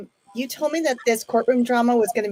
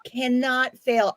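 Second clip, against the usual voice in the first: slow; much slower than usual. Acted. Slow repeated sales pitch.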